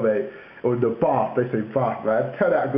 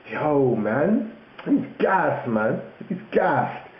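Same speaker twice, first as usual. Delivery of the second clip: high-pitched mock London lad; London slang; 3 semitones higher, much slower than usual. Calling out someone chatting rubbish, mock London accent. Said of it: "So they'd be like".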